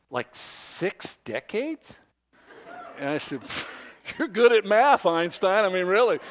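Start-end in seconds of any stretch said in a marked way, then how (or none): 0.1-2.0 lightly voiced woman, drawn out slowly in amazement; amazed slow question. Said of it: "she says". 4.2-6.2 higher, louder mock teasing; teasing sarcasm. Teasing the clerk.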